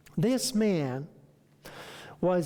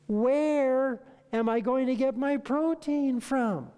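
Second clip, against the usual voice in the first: high, slow mock disbelief from the client; a client's slow, raised-pitch puzzlement; incredulous question; 7 semitones higher, slower than usual. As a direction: ask it as if the idea is absurd.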